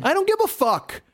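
high contemptuous retort; dismissive outburst. Real, not acted.

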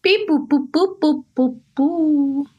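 high beep-boop robot noises. Acted. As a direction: chirp it out like a little robot. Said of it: "And Robot Serenity Caldwell".

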